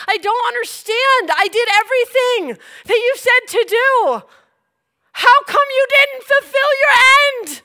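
high, loud, hurt accusations; angry yelling at God. Retold or imitated. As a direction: yell your grievance at God. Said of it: "I was yelling out".

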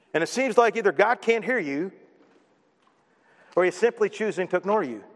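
normal talking voice

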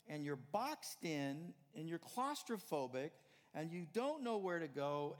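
loud, driving sermon voice; preaching. Acted. Preacher urging his congregation, loud and driving. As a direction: build the point with full pulpit force.

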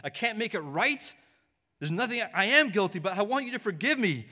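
high, loud pleading confession; crying out guilty. Acted. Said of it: "you're crying out right now".

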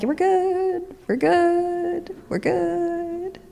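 high, soft we're good; mock-awkward high-pitched reassurance; nervous brush-off. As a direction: back away, high and sheepish. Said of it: "And Paul's kinda like".

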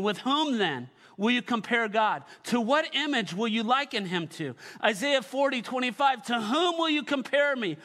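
loud, high proclaiming of the prophet's words; fervent scripture reading. Acted. Pastor reads Isaiah to church, proclaiming loudly. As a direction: declare it like God speaking.

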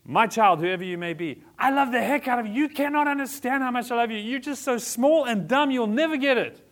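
high, loud, mocking parent talking down; mock condescending love. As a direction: sneer it as an impatient know-it-all parent. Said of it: "So I can't say".

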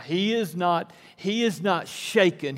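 usual speaking voice

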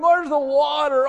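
high slow whiny complaint; mock whining. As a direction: whine it like a grumbler. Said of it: "they're murmuring".

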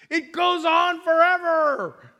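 high, loud, slow amazement; awed wonder. Retold or imitated. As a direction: let the wonder spill out. Niece awed by the ocean.

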